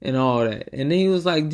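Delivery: ordinary delivery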